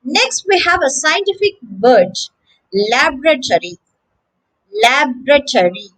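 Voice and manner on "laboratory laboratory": LAB-ruh-tory, stressed on the first syllable; American "laboratory", LAB-ruh-tory; American pronunciation. Shown as a demonstration. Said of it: "That's the American pronunciation".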